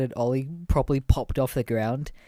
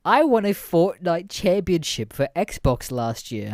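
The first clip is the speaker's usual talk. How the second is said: put-on higher, slower bragging kid voice; boastful kid; 5 semitones higher, 4 dB louder, slower than usual. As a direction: show off casually like the kid. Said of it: "And then he's just like".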